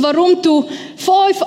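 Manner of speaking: ordinary talk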